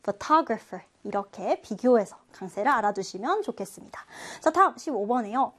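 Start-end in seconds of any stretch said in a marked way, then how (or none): 0.0-0.8 "photographer" with TOG stressed; stress on second syllable. Shown as a demonstration. Teacher repeating photographer for comparison, second-syllable stress. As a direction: say pho-TOG-rapher, leaning on the second syllable. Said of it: "두 번째 음절에 강세가 있습니다".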